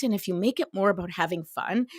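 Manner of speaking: ordinary delivery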